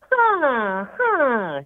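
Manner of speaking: high nasal honking huh. Shown as a demonstration. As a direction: honk it out through the nose. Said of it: "a soothing honk".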